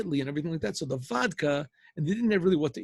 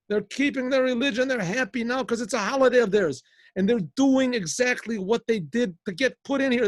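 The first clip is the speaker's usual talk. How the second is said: loud, raised-pitch tirade, building higher; indignant rant; 6 semitones higher, 6 dB louder than usual. Acted. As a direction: rail at them, punching out the accusations.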